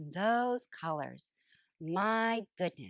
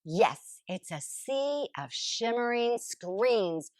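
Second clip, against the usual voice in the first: plain reading voice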